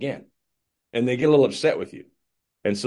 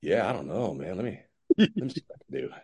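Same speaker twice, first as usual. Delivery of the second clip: softer, hesitant, doubtful mumble; unsure hedging; 4 semitones lower, 6 dB quieter, faster than usual. Retold or imitated. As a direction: stall as if it's really hard. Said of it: "be like".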